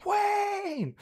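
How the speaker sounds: very high, drawn-out mock holler; shouted greeting. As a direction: imitate an over-the-top hello across a room. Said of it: "doing those greetings for people".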